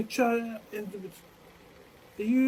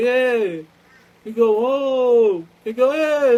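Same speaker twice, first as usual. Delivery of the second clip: mock shouts; 5 semitones higher, 12 dB louder, slower than usual. Acted exclamations, loud.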